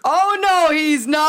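loud high panto shout back; panto crowd callback. Acted. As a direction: yell it back like a panto audience. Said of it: "you have to finish it off".

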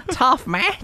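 high-pitched mock Australian accent; bad Aussie accent. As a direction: mimic a poor Australian accent, pitched well up. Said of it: "I do a bad Aussie accent".

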